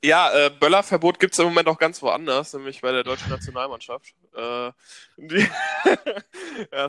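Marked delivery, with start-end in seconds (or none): 5.5-6.7 high giggling fit. Real, not acted. Cracks up mid-sentence.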